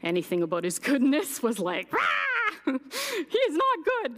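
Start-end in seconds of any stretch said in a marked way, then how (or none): 1.9-4.2 mock angry roar. Woman mocking her past rage at God, hammed-up angry roar. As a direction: ham up the furious rejection of God.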